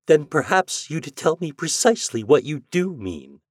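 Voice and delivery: cold, clipped demand, pitched high; clipped and hard. A narrator's reading. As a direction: bite each word off hard. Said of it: "Her voice was clipped and hard".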